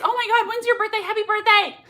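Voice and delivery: high, loud birthday squeal with a laugh; excited squeal. Real, not acted. Host squeals to a viewer about her birthday, excited.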